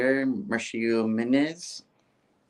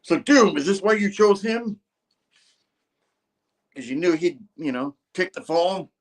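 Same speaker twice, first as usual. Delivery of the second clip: mild mock accusation, higher; a hint of an angry sorcerer; pissed Strange; 8 semitones higher than usual. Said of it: "Strange is all pissed".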